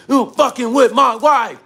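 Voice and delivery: retold outraged accusation; imitated angry accuser. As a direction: throw it out like an irate husband. Same yelling stranger quoted again.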